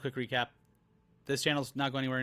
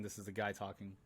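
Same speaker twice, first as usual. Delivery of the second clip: lower, softer guy voice; guy's voice; 6 semitones lower, 13 dB quieter than usual. Shown as a demonstration. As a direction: drop into a quiet low guy voice. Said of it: "same again".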